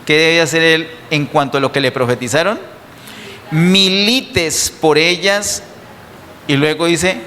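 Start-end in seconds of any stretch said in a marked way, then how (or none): 3.5-5.6 "Milites por ellas" slow, higher and weighty; slow preached stress on "Milites"; emphasized quote. Shown as a demonstration. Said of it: "Hay un énfasis en la milicia".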